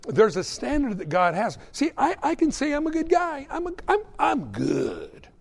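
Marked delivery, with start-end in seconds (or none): 1.7-4.0 very high-pitched mock self-defence; put-on high voice, protesting virtue; self-justifying. Acts out a self-justifying person. 4.2-5.3 lower, put-on self-satisfied voice; hammed-up low self-approval; self-assured. Mock self-assured answer.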